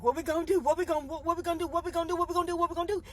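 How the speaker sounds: mock panic at the Red Sea; panicked Israelites. Israelites trapped by Pharaoh's chariots, mildly panicked.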